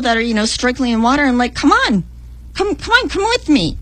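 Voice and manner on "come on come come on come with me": urging. Retold or imitated. Urging strugglers to follow her.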